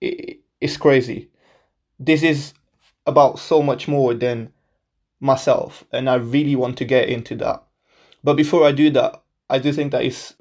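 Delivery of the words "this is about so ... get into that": ordinary talk, nothing marked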